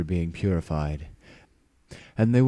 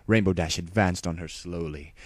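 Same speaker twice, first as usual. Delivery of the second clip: plain reading voice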